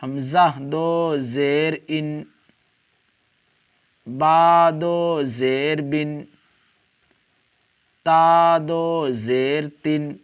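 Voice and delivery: slow, syllable by syllable; slow spelling drill. Shown as a demonstration. Teacher spells tanween slowly for Hamza. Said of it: "اسی طرح سے".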